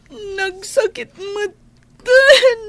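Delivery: slow, crying words; slow sob-broken line; tearful. Acted. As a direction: choke it out through tears. Jilted woman weeping over Frank.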